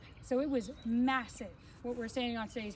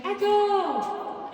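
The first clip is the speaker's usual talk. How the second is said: calling out to test the echo; hallway echo; 8 semitones higher, 6 dB louder than usual. Shown as a demonstration. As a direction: sing out one high loud word into the tunnel. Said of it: "And as you can hear".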